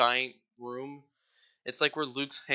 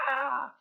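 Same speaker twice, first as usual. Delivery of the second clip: pained cry; 13 semitones higher than usual. Retold or imitated. Finn burned by lightsaber.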